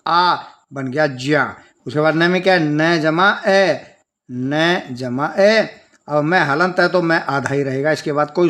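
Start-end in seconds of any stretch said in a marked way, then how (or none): 2.6-5.7 very slow, syllable by syllable; slow teacher dictation; slow spelling out. Shown as a demonstration. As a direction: dictate each part slowly for children. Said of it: "spelling out syllables slowly".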